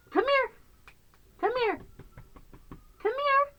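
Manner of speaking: very high, cooing pet call; high-pitched call to a pet; calling a kitten. Real, not acted. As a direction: coax a kitten over in a squeaky voice.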